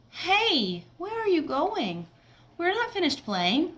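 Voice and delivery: put-on high, demanding child calling after friends; bossy kid voice. Rude kid calling after friends who leave, high and demanding. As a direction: whine at them to come back.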